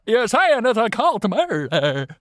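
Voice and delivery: high, slow, hammed-up shock; mock shock. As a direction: gasp it out like a soap opera. Mock-dramatic reaction.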